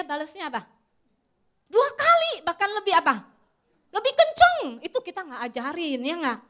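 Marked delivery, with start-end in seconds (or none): 1.7-4.8 higher and louder, punching each answer; emphatic shout. Acted. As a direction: call it out to the congregation like a punchline. Preacher mimics child hitting back.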